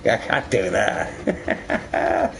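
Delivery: drawn-out, unvoiced mock complaint; lost player's voice. Player mocks the lost one, slow voiceless imitation. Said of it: "He's like".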